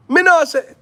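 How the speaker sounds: "Me know say" in high, loud Jamaican patois; Jamaican accent. Pretend. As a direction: toss off "Me know say" like a Jamaican youth. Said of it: "when I go to Jamaica".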